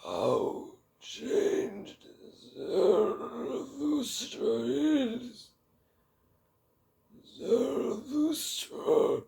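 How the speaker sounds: put-on very high, very slow, otherworldly old man's voice; eerie old hermit. Old forest hermit greets Zarathustra in a very high, slow, eerie voice. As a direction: stretch each word out like a strange ancient hermit.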